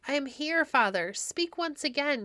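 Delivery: breathless plea, lightly done; gasped. A narrator's reading. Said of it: "he gasped".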